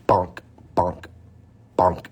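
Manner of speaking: usual speaking voice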